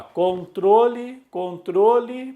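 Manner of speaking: CONTROLE slow, loud, TRO stretched high; stressed penultimate syllable. Shown as a demonstration. Teacher showing a remote as example, stress on TRO in "controle". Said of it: "paroxítona".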